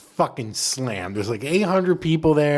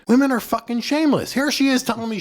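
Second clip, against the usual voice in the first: exclaimed; 10 semitones higher than usual. Retold or imitated. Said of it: "I'm like".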